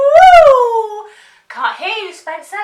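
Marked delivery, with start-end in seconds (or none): 0.1-1.1 loud, high siren wail; siren woo. Shown as a demonstration. Teacher showing a child a loud siren wail. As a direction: howl it out like an ambulance siren. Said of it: "So let's hear those sirens".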